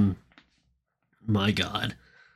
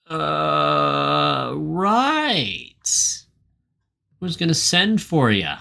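evasive stalling; 4 semitones higher, much slower than usual. Retold or imitated. Host voices boss fobbing off his girlfriend, evasive and stalling. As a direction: drag it out as an unconvincing fib. Retold Cliff stalling Halle Berry.

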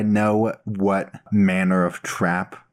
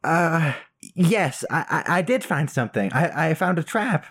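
higher, stammering nervous voice; flustered guilty character; 6 semitones higher than usual. Acted. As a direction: caught out, fumble for words.

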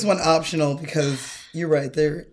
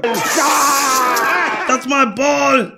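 higher, shouted then slow; Arnold impression; 7 semitones higher, much slower than usual. Imitating Arnold in the movie, high mock shout. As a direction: yell like a cartoon Arnold. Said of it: "he's going".

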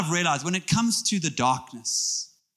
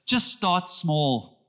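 Just start small, each word drawn out slowly; slow it right down and land every word; slow emphasis; much slower than usual. Shown as a demonstration. Pastor giving the church a line to repeat, slow. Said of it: "Turn to someone and say".

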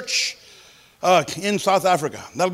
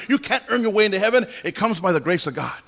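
ordinary talk

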